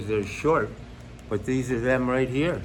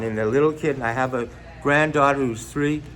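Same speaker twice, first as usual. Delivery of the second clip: ordinary talk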